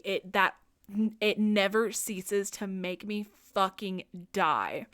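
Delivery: ordinary talk, nothing marked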